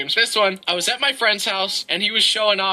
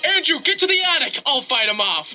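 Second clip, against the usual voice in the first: high urgent order, easing at the end; urgent command; 6 semitones higher than usual. Retold or imitated. Dreamer sending Andrew upstairs during the attack, urgent. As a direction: send a friend to safety.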